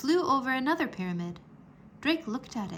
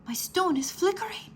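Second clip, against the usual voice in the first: gasp; 9 semitones higher than usual. A narrator's reading. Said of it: "he gasped".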